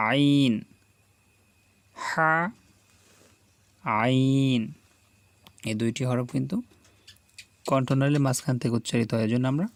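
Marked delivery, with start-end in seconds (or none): 0.1-4.6 আইন and হা pressed from mid-throat; throaty pressed আইন and হা; pressed throat letters. Shown as a demonstration. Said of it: "যখনই বলবো চেপে বলবো".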